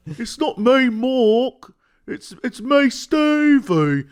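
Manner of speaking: put-on high, slow British accent as the Moon Knight guy; mock British Stevie introducing himself; British accent impression. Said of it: "this British accent".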